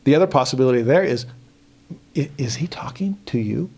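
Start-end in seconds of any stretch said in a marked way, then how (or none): 2.2-3.7 mock whisper. Mocking a silent god.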